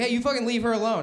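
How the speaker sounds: mock outburst. Angry outburst in fluent Chinese.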